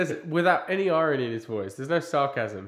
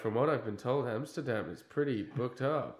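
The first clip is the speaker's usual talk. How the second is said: deadpan; 3 semitones lower, 7 dB quieter than usual. Retold or imitated. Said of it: "It's very straight faced".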